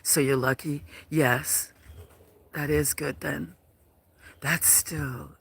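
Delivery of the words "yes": normal talking voice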